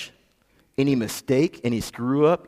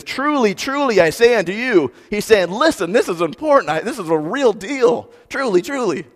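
higher, insistent truly truly; emphatic Jesus quote; 7 semitones higher than usual. Retold or imitated. Jesus urging listeners, high and insistent. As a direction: lean in like it's urgent. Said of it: "when Jesus says".

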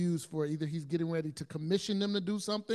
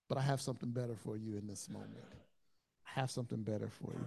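tender reassurance; 6 semitones lower, 6 dB quieter than usual. Retold or imitated. Jesus reassuring a frustrated believer, low soft tenderness. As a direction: hush them gently, like soothing a friend.

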